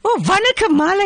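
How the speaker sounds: auntie voice jumps high with joy; delighted greeting. Acted. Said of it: "You sound so excited".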